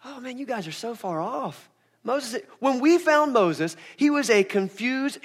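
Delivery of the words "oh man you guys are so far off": dismissive Jesus. Retold or imitated. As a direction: brush off their Moses claim. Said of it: "He's like".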